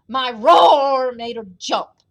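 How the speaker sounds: loud, slow brag; big proud lion voice; boasting lion. A narrator's reading. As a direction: brag with a drawn-out roar. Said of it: "boasted the lion".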